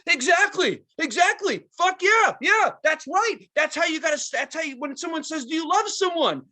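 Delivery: high, loud gushing rock-star hype; mocking a frontman's high, excited stage banter; Wayne hyped. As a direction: gush back at the crowd like a stoned frontman. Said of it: "And he would go".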